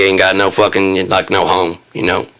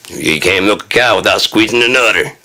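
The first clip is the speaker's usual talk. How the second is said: ordinary delivery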